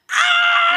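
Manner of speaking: very high mock scream; hammed-up high-pitched wail; imitated scream. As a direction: shriek like a terrified rider. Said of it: "Harold the entire time goes".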